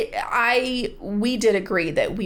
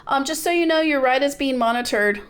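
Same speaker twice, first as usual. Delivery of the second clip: PA announcer voice; 7 semitones higher than usual. Retold or imitated. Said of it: "the P A came over and was like".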